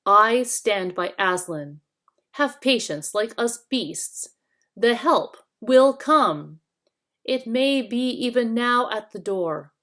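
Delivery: a hint of a patient old badger, steady and earnest; lightly voiced badger. Badger voice in reading.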